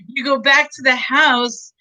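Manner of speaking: ordinary talk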